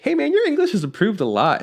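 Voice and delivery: high, mocking impression of a friendly local; teasing high voice. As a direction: pitch it way up and play it teasing. People mocking his English.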